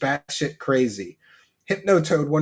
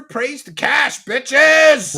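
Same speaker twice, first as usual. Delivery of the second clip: mock cult cheer; 8 semitones higher, 8 dB louder, much slower than usual.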